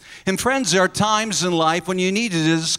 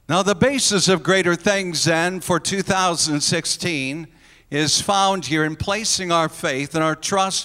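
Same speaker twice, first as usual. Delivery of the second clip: normal talking voice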